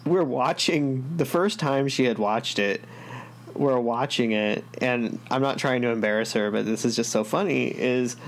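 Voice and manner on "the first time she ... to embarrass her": ordinary talk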